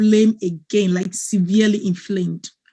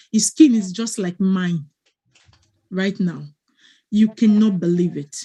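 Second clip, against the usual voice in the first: ordinary delivery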